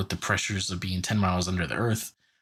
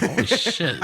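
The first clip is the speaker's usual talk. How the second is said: amazed exclamation; 9 semitones higher, 5 dB louder, slower than usual. Retold or imitated. Amazed at their strength.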